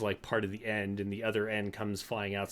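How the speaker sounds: usual speaking voice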